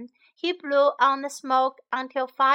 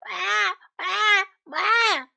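high-pitched mock baby crying; baby cub wail; 9 semitones higher, 4 dB louder, slower than usual. Woken bear cub crying, high mock wail. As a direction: imitate a wailing baby. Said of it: "A baby cub wailed".

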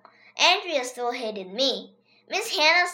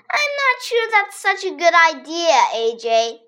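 put-on higher, prim girl's voice; a doubtful classmate, raised pitch; 5 semitones higher, 4 dB louder than usual. A narrator's reading. Andrea doubts AJ's plan, prim girl voice. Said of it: "Andrea said".

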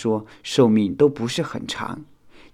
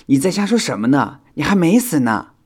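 higher, louder quick correction; hasty explaining; 6 semitones higher, 8 dB louder than usual. A narrator's reading. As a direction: hurry to set a friend straight. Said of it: "修想连忙解释". (连忙解释)

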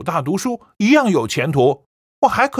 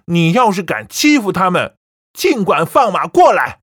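louder, ending in a defiant shout; shouted challenge; 8 dB louder than usual. A narrator's reading. Son daring his mother to bully grandparents, defiant shout. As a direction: dare her to try.

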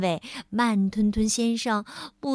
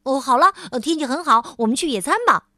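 louder, brisk and rushed; speaking quickly; 2 semitones higher, 6 dB louder, faster than usual. Acted. Mr. Busy proposing a picnic, quick and loud. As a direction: rattle it off eagerly. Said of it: "忙碌先生快速地说".

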